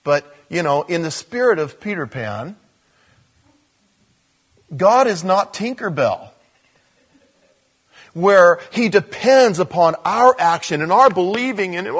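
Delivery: ordinary talk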